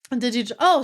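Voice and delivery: usual speaking voice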